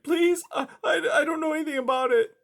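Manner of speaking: high, timid plea; hesitant high pleading voice; pleading mouse. A narrator's reading. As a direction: beg like a frightened little mouse. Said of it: "Blinker pleaded".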